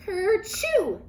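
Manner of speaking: loud, high acted sneeze; a big sudden kerchoo; a cartoon rabbit sneeze. A narrator's reading. Peter sneezes in story.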